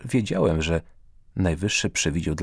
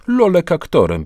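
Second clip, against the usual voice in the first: a hint of a fussy elderly aunt, higher and louder; mock-scandalised aunt voice, lightly done; imitating an old lady; 9 semitones higher, 7 dB louder than usual. Karol mocking his aunts, lightly voiced old lady. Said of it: "naśladując starszą panią".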